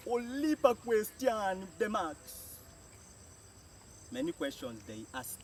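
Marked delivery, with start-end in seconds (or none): none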